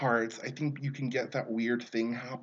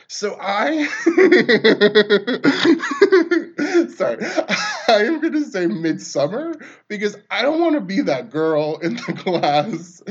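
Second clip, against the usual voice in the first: laughing through the words; can't stop laughing while talking; 7 semitones higher, 12 dB louder than usual. Real, not acted. Telling a story about a classmate, laughing hard. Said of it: "laughing fit".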